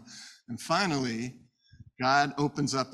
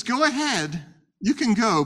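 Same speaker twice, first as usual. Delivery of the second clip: high, loud, emphatic go-ahead; God granting permission; 9 semitones higher, 7 dB louder than usual. Acted. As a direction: wave them on with a big, raised voice.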